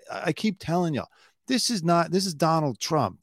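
ordinary delivery

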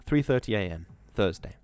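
ordinary delivery

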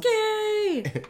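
high, stretched-out mock scream; hammed-up falling cry; anime name yelling. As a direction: wail a rival's name like an anime hero. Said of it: "they yell names all the".

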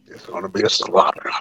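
low whispered slaughter line; hushed low J R voice; J R impression. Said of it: "As the great J R would say".

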